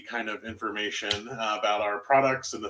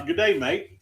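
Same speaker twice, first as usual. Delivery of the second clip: put-on higher-pitched go at an Australian accent; attempted Australian accent; 5 semitones higher, 4 dB louder than usual. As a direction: have a try at an Australian greeting. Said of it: "Okay, you try it".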